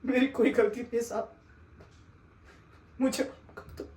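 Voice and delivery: pleading hush, fading to a whisper; whispered plea. Acted. Begging the sahib to forgive him, whispered plea.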